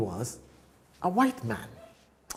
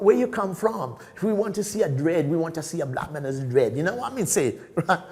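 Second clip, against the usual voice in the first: normal talking voice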